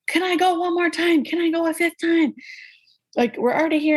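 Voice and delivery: eager doctor. Retold or imitated. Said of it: "all I hear is my doctor, like".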